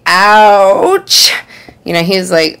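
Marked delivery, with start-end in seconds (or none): 0.0-1.3 a higher, louder, put-on "Ouch"; mock pained ouch with a rising end; exaggerated ouch. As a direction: ham up a pained little ouch. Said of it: "I made her say".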